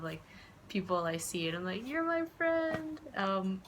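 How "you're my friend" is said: much higher and slow "You're my friend."; sweet greeting. Retold or imitated. Greeting random street vendors, "You're my friend." sung out high and slow.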